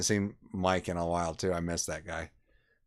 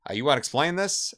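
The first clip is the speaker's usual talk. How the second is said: quicker, higher mock police questioning; pretend cop; 7 semitones higher, 4 dB louder, faster than usual. Prank cop demanding an explanation from a friend, pretend cop. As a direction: press him like a make-believe officer. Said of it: "I'm acting like a cop".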